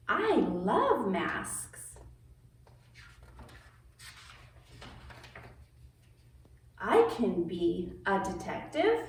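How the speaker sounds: lively picture-book read-aloud; reading to children. A narrator's reading. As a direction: read it like story time for kids.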